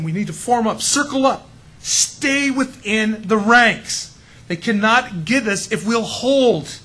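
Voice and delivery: rally the troops to hold formation; slow, forceful movie-hero command. Retold or imitated. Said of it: "you know, our hero says".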